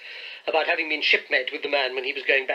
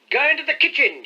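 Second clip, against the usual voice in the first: much higher, louder call; 12 semitones higher, 8 dB louder than usual. A narrator's reading. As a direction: call it out for all to hear. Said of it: "he continued out loud".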